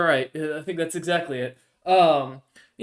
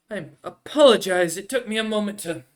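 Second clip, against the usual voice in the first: noble lady voice; 4 semitones higher, 6 dB louder than usual. Acted. GM voices Lady Flare arriving.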